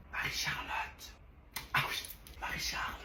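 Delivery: sneak her name in a whisper. Acted. Exam whispering.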